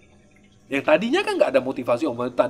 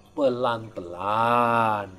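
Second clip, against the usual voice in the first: "Pelan-pelan" stretched long and slow; drawn out slowly; 4 semitones lower, much slower than usual. Shown as a demonstration. Teacher to students, drawing out "Pelan-pelan" very slowly. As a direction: let "Pelan-pelan" crawl, acting out slowness.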